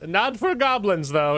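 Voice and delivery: high, bright know-it-all; innocently quizzing the players; high-pitched clueless humanoid; Tech, curious and oblivious. Acted. GM plays Tech.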